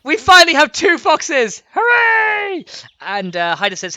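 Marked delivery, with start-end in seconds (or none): 0.0-2.6 high, loud, stretched-out shouting; jubilant cheer. Pretend. Celebrating finally having two foxes, jubilant shouting. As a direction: whoop it out in celebration.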